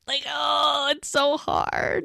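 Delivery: high, drawn-out sympathetic wail, kept light; agonizing. Real, not acted. Host torn over whether she really wanna know, agonized. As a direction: stretch it out high, feeling the dilemma.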